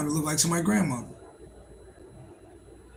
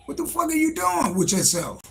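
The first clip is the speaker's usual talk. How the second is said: incredulous; 10 semitones higher than usual. Retold or imitated. Scolding smokers.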